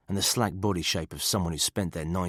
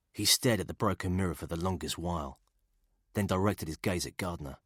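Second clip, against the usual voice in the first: ordinary delivery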